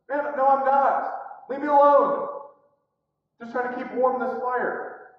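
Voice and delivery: loud, defensive rebuff; irritated denial. Retold or imitated. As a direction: brush the accuser off forcefully. Peter's second denial.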